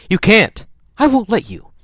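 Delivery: put-on high, loud child-character protest; loud, high-voiced objection; alarmed protest. A narrator's reading. Scout tries to stop Jem.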